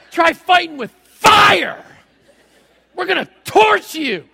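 high, loud, slow shouted threat; taunting shout. Retold or imitated. Servant shouting threats at the Aramean army. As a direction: yell it at the enemy. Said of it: "talking smack".

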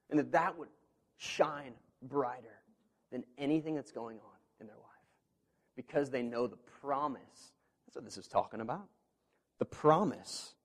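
ordinary talk

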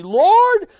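loud, high cry for an answer; insistent, pleading shout; urgent cry. Acted. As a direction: call out urgently to God. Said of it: "Can you hear the urgency".

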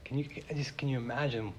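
whisper. Real, not acted. Awed rhetorical question to congregation.

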